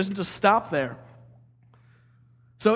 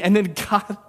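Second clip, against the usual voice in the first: normal talking voice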